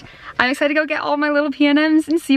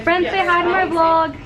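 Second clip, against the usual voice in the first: high, bright excited squeal; 7 semitones higher than usual. Real, not acted. Excited friends greeting vlog.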